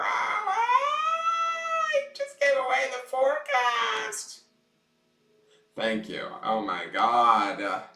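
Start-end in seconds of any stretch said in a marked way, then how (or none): 0.0-4.4 high, slow dismayed cry; high wailing voice. Real, not acted. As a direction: squeal it in dismay. Self-scolding in high voice.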